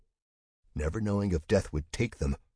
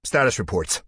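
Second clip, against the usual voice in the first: commanding; 5 semitones higher, 7 dB louder than usual. Acted. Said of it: "Duggan commanded".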